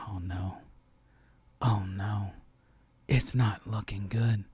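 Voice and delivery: low, hushed, slow "oh no" of sinking dread; dread-filled whisper. Retold or imitated. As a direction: whisper it as if watching a disaster unfold. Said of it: "and I was like".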